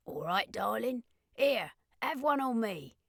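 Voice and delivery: put-on friendly, guttural thick Cockney accent. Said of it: "in an extremely guttural, thick Cockney accent".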